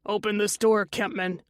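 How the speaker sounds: ordinary delivery, nothing marked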